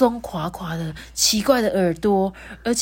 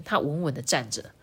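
usual speaking voice

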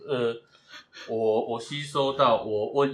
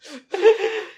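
loud high laugh that climbs; bright burst of laughter; high rising laugh; 6 dB louder than usual. Real, not acted. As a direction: let a laugh rise high. Laughing at partner's joke.

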